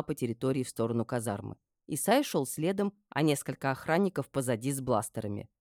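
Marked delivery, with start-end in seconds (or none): none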